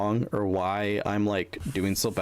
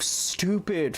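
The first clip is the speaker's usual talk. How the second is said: ordinary talk, nothing marked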